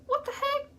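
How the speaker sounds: high-pitched mock bewilderment; baffled reaction. Girl retelling the lamp turning off, baffled and high. Acting out startled confusion.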